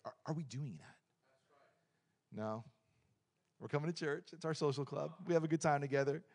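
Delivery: normal talking voice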